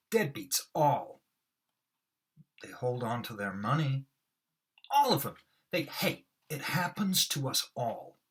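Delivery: hammed-up lament; overacted Moss. Shown as a demonstration. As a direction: play it up with a catch in the voice. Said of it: "I really overact them".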